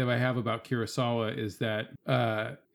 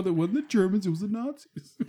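a hint of crying, higher; mock tearful correction; 7 semitones higher than usual. Father retelling his reply to his crying son, mock tearful. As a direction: correct him through mock tears. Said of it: "I go".

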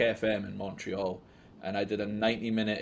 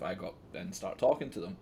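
ordinary talk

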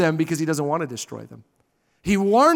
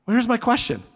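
ordinary talk, nothing marked

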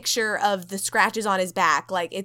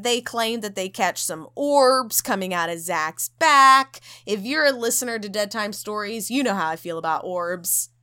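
ordinary talk

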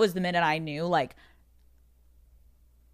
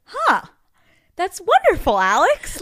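high, loud mock gasp of delight; astonished therapist; 12 semitones higher, 7 dB louder than usual. Therapist hearing the marriage news, astonished. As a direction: imitate a therapist reacting with exaggerated amazement.

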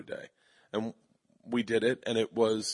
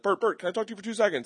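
eager writers; 9 semitones higher, much faster than usual. Retold or imitated. Writers chasing him, quoted.

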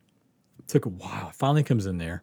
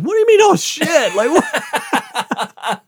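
much higher, louder alarmed outburst; panicked disbelief; 4 dB louder, slower than usual. Retold or imitated. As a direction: shriek of alarm at the dentist. Panicked reply to dentist's "oh shit.

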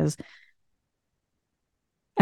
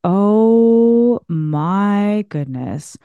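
slow awe; much slower than usual. Retold or imitated. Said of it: "And I'm like".

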